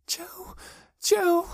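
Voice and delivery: very high, thin whimper. A narrator's reading. Girl shaking with terror over missing Joe, whimper. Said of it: "she whimpered".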